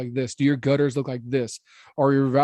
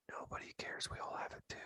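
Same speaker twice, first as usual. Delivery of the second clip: hushed, voiceless aside; whisper; 23 dB quieter than usual. Acted. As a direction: drop right down into a whisper. Whispered answer to retold worry.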